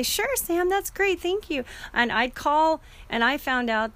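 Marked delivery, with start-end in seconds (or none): 0.0-1.6 sweet high voice for mom's easy agreement; imitating mom. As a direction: play her, higher and agreeable. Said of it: "She'd say".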